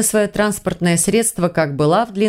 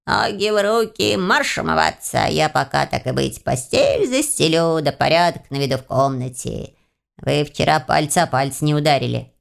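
bossy house spirit; 3 semitones lower than usual. A narrator's reading. House spirit sending heroine to wash, bossy grumble. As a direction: order them about, then grumble over the chores.

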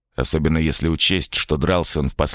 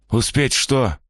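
puzzled question; 8 semitones higher, slower than usual. Acted. Man asks puzzled.

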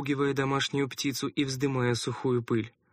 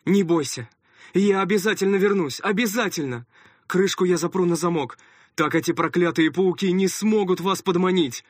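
hurried reassurance; 5 semitones higher, 6 dB louder than usual. A narrator's reading. Father reassures daughter in haste.

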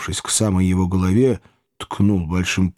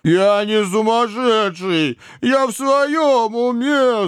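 much higher, very slow whine; whimpering; 12 semitones higher, much slower than usual. A narrator's reading. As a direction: whimper like a frightened child. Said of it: "захныкал жирный парень". (хнычет, протяжно и тонко)

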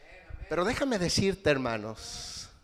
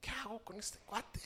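imitating tearful wife; 4 semitones higher, 13 dB quieter than usual. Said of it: "mi esposa vino llorando y me dijo".

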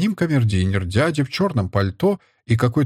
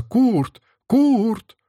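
very high-pitched searching call; drawn-up, high summons; 12 semitones higher than usual. A narrator's reading. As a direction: call for someone missing. Mother calls for missing Kurt.

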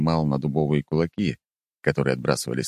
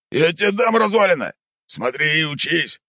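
high, louder indignant snap; furious old uncle; angry snort; 12 semitones higher, 3 dB louder than usual. Acted. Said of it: "зло фыркнул Тихонович".

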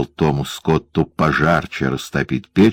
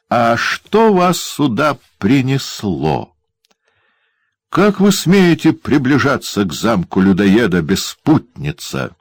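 mocking dwarf; 7 semitones higher than usual. A narrator's reading. Dwarf taunts his wife.

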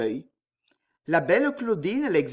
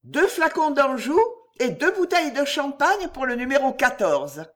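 calling down the stairs; 7 semitones higher than usual. A narrator's reading. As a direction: shout the order over the banister. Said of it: "se pencha sur la rampe et cria".

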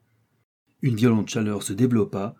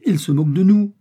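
high, loud protest; indignant exclamation; 9 semitones higher, 8 dB louder than usual. A narrator's reading. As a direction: cry out as if mocked. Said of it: "s'écria Joe".

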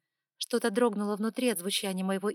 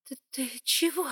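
higher startled stammer; frightened gasp; 6 semitones higher, 4 dB louder than usual. Acted. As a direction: breathe it out in fright. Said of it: "испуганно выдохнула я".

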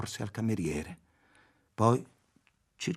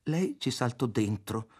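plain reading voice

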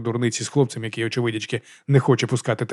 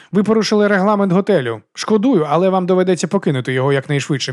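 high, raised official voice settling down; stern hotel official; 7 semitones higher, 4 dB louder than usual. Acted. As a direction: deliver the eviction formally, starting high and loud.

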